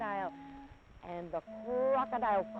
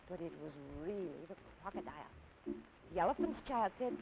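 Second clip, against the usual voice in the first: usual speaking voice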